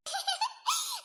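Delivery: high, soft burst of giggling; irrepressible giggle. Acted. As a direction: let a stifled laugh escape. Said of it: "हंसी तो मुझे इस बात पे आ रही है". (दबी हुई खिलखिलाहट)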